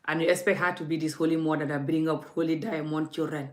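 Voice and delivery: ordinary delivery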